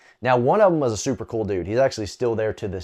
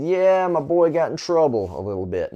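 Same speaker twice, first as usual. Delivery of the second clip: sarcastic; 5 semitones higher than usual. Retold or imitated. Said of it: "He was being sarcastic".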